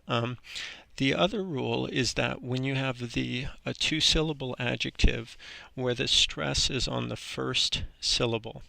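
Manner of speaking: ordinary talk, nothing marked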